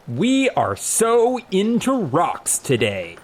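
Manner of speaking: loud, high, slow cheer; big stretched-out rallying cry; banner proclamation. A narrator's reading. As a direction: proclaim it like a crowd's slogan. Reading out a fan banner.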